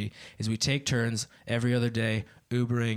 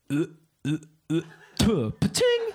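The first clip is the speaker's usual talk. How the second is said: mock Wild West impression; 2 semitones higher than usual. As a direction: imitate spitting into a ringing spittoon. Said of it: "This is my impression of a blowjob in the Wild Wild West".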